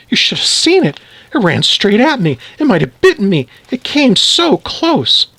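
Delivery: high, breathless-with-alarm retelling; worked-up wife. Acted. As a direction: relive the scare, voice pitched high. Wife relives scare, told excited.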